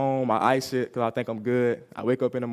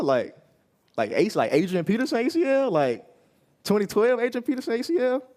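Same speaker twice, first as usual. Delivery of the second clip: much higher, incredulous question; can't believe how bad it is; high stunned repeat of the injury; stunned disbelief; 10 semitones higher than usual. Stunned by torn ACL news.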